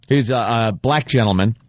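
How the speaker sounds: ordinary talk, nothing marked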